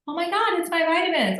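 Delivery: hammed-up imitation of a bubbly friend; mocking the friend. Retelling the friend's reply about her vitamins, high mock-chirpy voice.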